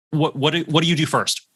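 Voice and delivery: usual speaking voice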